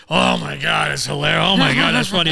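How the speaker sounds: hammed-up hilarity in a put-on voice; exaggerated fan reaction; mock gushing. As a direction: pretend to be falling about at a joke. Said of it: "when I watch, I'm not eating".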